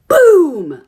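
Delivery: shouted sound effect boom; loud crash word, retold. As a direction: punch it out like an impact. Retelling the crash.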